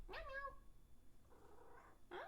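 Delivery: breathy whispered meows at the cat. Pretend. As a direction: whisper "Meow, meow" like a tiny kitten. Meowing at her cat.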